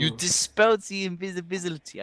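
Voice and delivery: high elf voice, insisting; high-pitched character objection; flamboyant elf, protesting. Acted. Game master voicing the elf.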